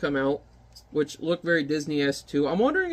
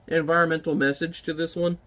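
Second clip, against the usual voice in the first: normal talking voice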